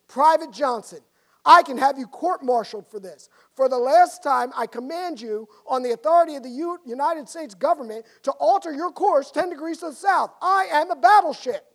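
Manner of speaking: loud, furious captain's command; angry growl. Acted. Captain angrily ordering the private to turn. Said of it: "the captain growled back over the radio".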